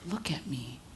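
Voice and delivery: soft, hushed whisper. Acted. Uncle lifting her chin, whispering softly. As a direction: breathe it gently, barely voiced.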